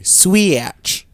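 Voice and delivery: "Switch" stretched into swee-atch, high and loud; mocking pronunciation. Host mimicking co-host's word, "Switch" as a loud, high swee-atch. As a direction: exaggerate "Switch" into two sliding syllables. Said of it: "You just say".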